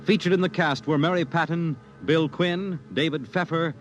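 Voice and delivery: ordinary talk, nothing marked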